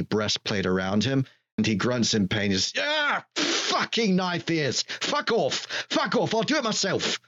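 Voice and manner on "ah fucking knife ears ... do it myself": high furious bellow at the elves; angry shout. Acted. Arl roaring at the elves fitting his armor, furious. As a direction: snarl and roar it out, high and angry.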